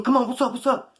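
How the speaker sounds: high, loud mock tough-guy taunt; mocking loud flexing. Imitating Ryan flexing loudly at the narrator, loud mock taunt. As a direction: puff up and taunt like a blowhard. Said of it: "Being real loud, flexing".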